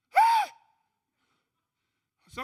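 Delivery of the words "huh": high, loud stunned huh; shocked huh. Acted. Defendant asked to speak before sentencing, stunned huh. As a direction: yelp in disbelief.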